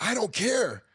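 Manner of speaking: high, frantic retold outburst; freaking out. Panicking husband snapping back at wife, freaking out. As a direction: whine it out in mock panic. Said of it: "You're freaking out".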